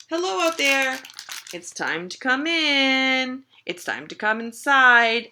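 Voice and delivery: loud singsong hail with stretched endings; calling out. Acted. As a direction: call the pets in from far off. Carer calls pets in from outside.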